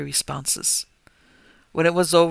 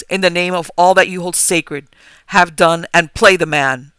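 loud firm cry; 2 semitones higher, 11 dB louder than usual. A narrator's reading. Narrator shouting at the spellbound rector, loud and firm. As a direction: snap him out of the trance. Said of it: "I cried in a loud, firm voice".

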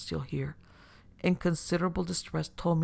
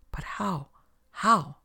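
baffled questioning; 3 semitones higher, much slower than usual. A narrator's reading. Old host left behind questioning his companion, bewildered. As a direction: repeat the question with rising bewilderment. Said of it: "This was Bonesteel".

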